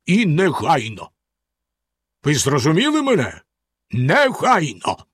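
stern, emphatic command; strict order with swooping emphasis. A narrator's reading. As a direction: demand obedience, stretching the final word. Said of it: "суворо сказав він".

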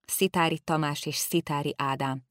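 plain reading voice

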